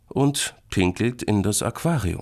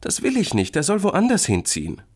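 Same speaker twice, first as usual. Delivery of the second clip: put-on high, whiny-child objection; protesting small boy; 12 semitones higher, 4 dB louder than usual. Boy refuses to share home with creature, protesting. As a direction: object strongly, pitched high.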